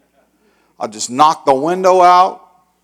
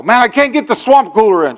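exasperated, higher-pitched complaint; frustrated giving up; 6 semitones higher, 4 dB louder than usual. Retold or imitated. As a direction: throw up your hands in defeat. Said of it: "I give up".

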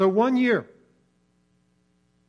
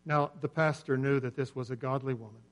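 normal talking voice

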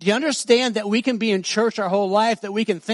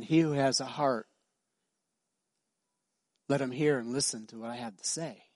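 much softer and lower, intimate; soft gentle rendering; 5 semitones lower, 8 dB quieter than usual. Acted. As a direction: say it tenderly, as if to one person. Same saying re-voiced gently.

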